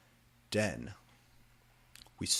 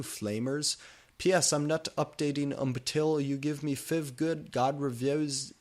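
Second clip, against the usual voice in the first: mock rant; 6 semitones higher, 4 dB louder than usual. Author's note ranting at flamers.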